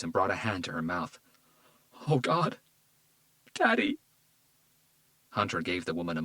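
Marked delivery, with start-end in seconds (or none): 2.1-4.0 high, breaking cry of grief; grief-stricken. Acted. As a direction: realise he's gone. Daughter learns father died.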